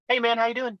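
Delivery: high, easygoing mock greeting; suddenly friendly. Friend after his nap to teller, innocently friendly imitation. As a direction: greet him as if nothing happened. Friend wakes up cheerful, different person.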